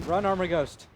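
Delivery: loud urging call; urgent cheer. Real, not acted. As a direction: call out to a player to run. Urging a player to flee.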